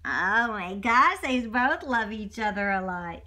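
ordinary delivery

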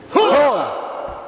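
full-throated army battle cry; loud gung-ho soldier. Real, not acted. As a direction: shout it out like a drill sergeant. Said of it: "give me a hua".

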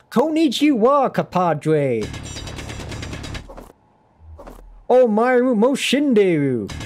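hammed-up Southern drawl over Japanese phrases; Japanese in a Southern accent. Streamer demos her Japanese slipping into a Southern accent. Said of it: "I'll sometimes go full Southern when I'm doing it".

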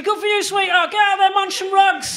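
overly enthusiastic dad; hammed-up cheer. Dad cheering his daughter's coming out, in a hammed-up shout. Dad's imagined cheerful reply.

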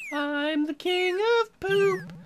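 high slow grand poop voice; poop king voice. Pretend. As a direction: announce it slowly and high. Poop character boasts.

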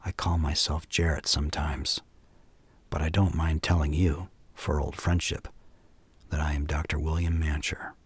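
low, soft confidential whisper. A narrator's reading. Stranger bending toward two men to share his name, whispered. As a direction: lean in and confide it, sinking into a whisper. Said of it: "said in a whisper".

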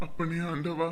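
hushed appeal to God; quiet prayer. Acted. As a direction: pray softly to yourself. Husband prays alone after comforting wife.

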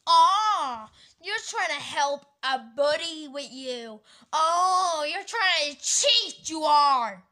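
high, loud, stretched-out indignation; loud accusation. Pretend. Seller accusing customer of trying to cheat, loud indignation. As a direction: call out the cheat with drawn-out outrage.

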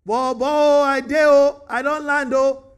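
loud, slow, high Nigerian Pidgin drawl; hollered Pidgin answer, slow and lazy; pidgin reply. Acted. Adam answering God in loud, slow Nigerian Pidgin.

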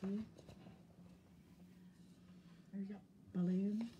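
plain reading voice

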